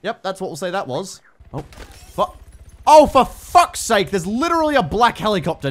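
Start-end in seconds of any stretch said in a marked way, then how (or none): 1.5-4.1 loud, high, slow exasperated cry; exasperated outburst. Real, not acted. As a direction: burst out, stretching each word.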